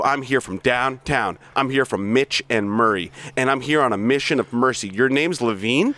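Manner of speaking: hard-driving sales boss berating staff; bully the salesmen with contempt; reading Blake's tirade, pushy and mocking; aggressive sales boss. Plays Blake monologue.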